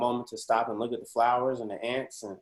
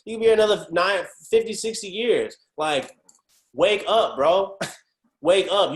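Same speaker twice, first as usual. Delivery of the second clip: raised, higher voice, pushing back hard; loud disbelief, shaking him awake; higher and louder, almost a mock yell; incredulous rant; 7 semitones higher, 6 dB louder than usual. Exasperated rant at older friend.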